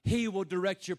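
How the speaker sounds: normal talking voice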